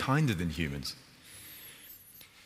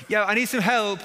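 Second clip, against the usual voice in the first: high, loud eager answer; eager plea; 11 semitones higher, 5 dB louder than usual. Acted. Villager answering the neighbour through the door, high eager pleading. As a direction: rush to explain yourself, hopeful.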